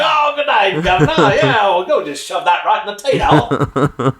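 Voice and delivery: put-on a jokey London gent saying goodnight in a British accent; doffing his hat, mock British accent. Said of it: "wishing you".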